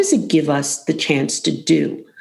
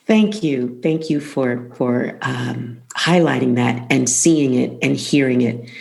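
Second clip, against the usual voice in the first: getting emotional; 5 semitones lower than usual. Real, not acted. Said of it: "I'm getting emotional again".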